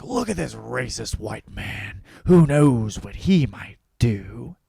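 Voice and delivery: slow, put-on terrified voice; a slow mock-fearful commuter; mock scared commuters. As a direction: play frightened subway riders, slowing down. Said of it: "they're like".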